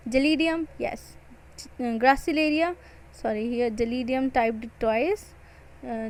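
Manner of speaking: normal talking voice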